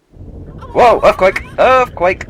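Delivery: high, loud mock alarm; alarmed shout. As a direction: cry out as the ground shakes. Shaking statue, mock alarm.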